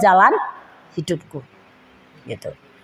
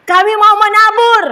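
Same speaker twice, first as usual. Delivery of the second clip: higher, louder, eager quoted voice; eager church members; 9 semitones higher, 5 dB louder than usual. Retold or imitated. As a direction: voice the keen congregation.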